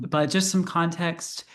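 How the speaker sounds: ordinary delivery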